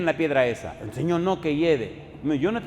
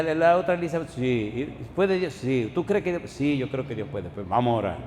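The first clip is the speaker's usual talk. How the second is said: normal talking voice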